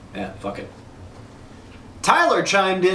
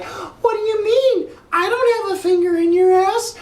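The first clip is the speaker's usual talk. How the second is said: mock wife; 12 semitones higher than usual. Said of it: "she's gonna be like".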